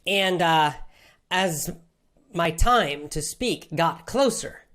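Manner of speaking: ordinary talk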